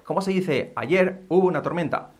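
normal talking voice

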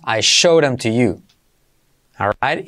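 ordinary delivery

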